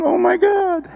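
very high, overwhelmed exclamation; high-pitched retold wail; retold tearful cry. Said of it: "and now I'm crying. And I'm going".

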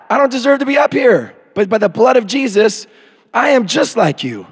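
normal talking voice

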